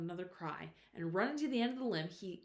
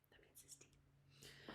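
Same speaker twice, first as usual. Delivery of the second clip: soft slow whispered aside; 29 dB quieter, much slower than usual. Real, not acted. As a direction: drop to a quiet whisper. Aside to listener.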